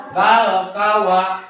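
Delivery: "kal kawa" with an added a after w; wrong reading. Shown as a demonstration. Teacher shows what not to read, "kawa" with an extra vowel. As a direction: open "kau" into two slow syllables, "ka-wa". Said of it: "Jangan dibaca".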